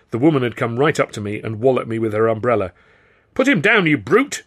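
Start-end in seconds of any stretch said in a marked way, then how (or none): 3.3-4.4 high, loud furious shriek. A narrator's reading. Woman attacking the stranger with her umbrella, shrieking. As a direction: shriek at the stranger holding the boy. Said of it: "she shrieked".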